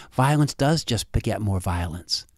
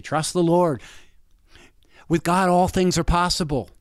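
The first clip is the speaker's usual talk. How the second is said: a lightly voiced, higher preacherly youth pastor; 7 semitones higher than usual. Youth pastor in his head urging faith, a hint of a preacher's voice. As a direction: gently preach it like a church mentor. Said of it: "youth pastor whispering in my ear".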